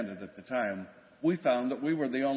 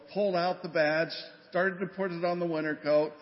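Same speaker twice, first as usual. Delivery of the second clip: ordinary talk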